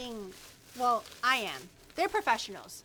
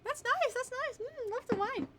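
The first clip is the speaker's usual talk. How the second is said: much higher, savouring; pleased; 11 semitones higher, 4 dB quieter than usual. Real, not acted. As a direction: relish the taste. Savouring the wine.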